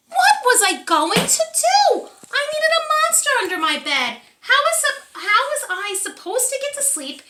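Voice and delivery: put-on high, loud anxious child voice; worried child. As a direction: panicky little kid, voice up high. Child panics about missing monster.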